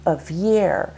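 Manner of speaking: "of year" with a light "of" and stressed "year". Shown as a demonstration. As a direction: lean on "year" in "of year". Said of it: "year is stressed".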